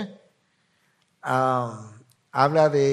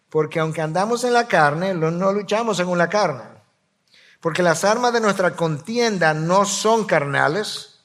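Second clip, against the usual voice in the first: louder, declamatory sermon voice; forceful scripture reading; 2 semitones higher, 5 dB louder than usual. Acted. Pastor reading Corinthians to congregation, forceful and louder. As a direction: proclaim the verse like a battle cry.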